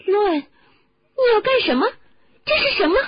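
high, loud, panicked questions; alarmed shout. Acted. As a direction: cry out in alarm at a raging friend. Startled by roaring, shaking Ron.

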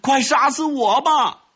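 very high, despairing shout; high-pitched cry of agony; anguished scream. Acted. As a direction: scream it out, half mad with remorse. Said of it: "他大喊一声".

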